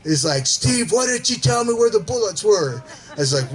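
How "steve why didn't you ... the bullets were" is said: mock-furious, high-pitched complaint; retold fury. Buddy back from the ridge confronts Steve, mock fury. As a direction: imitate an outraged hunting buddy calling you out. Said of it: "he is furious at me".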